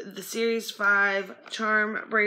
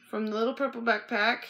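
ordinary talk

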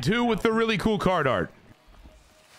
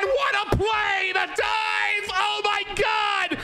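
ecstatic shouting; 13 semitones higher, slower than usual. Real, not acted. Gamer screaming as his fielder's dive wins the game, ecstatic.

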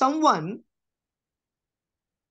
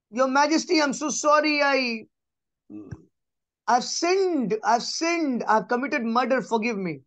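a pleading plea, pitched higher; mock pleading murderer; 5 semitones higher than usual. Preacher voices a murderer begging the judge, pitched higher and pleading. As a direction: beg the judge for mercy. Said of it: "the person is pleading and saying".

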